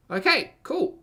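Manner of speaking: sarcastic squeak. Real, not acted. Sarcastic reply to read passage.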